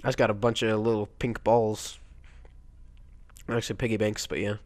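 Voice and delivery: ordinary talk, nothing marked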